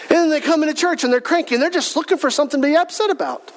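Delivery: ordinary delivery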